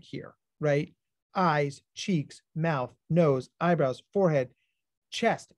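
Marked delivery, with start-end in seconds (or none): none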